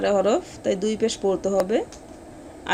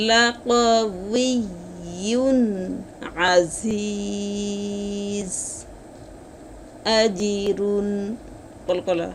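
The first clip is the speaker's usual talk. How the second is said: slow melodic tajweed chant, vowels stretched long; Quran recitation; much slower than usual. Shown as a demonstration. Tajweed teacher reciting the verse to students, slow melodic chant. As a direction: intone each word slowly like tilawat. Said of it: "মাদ্দে আরজি তিন আলিফ টানিয়ে পড়তে হয়".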